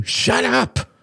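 very high, furious outburst; angry hiss. A narrator's reading. Robert silences a friend insulting his girlfriend, angry hiss. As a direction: cut in with high-pitched anger. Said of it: "hissed Robert, his face red".

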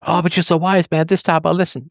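a hint of high, fawning flattery; mock flattery, pitched a bit up; flattering caller. Mimics a flattering listener.

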